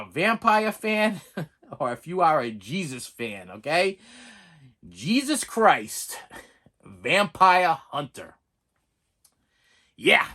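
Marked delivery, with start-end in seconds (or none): 4.9-8.3 slow, weighty title read; dramatic slow announcement; slow title announce. Shown as a demonstration. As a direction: spell out the title like a trailer voice. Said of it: "I said it".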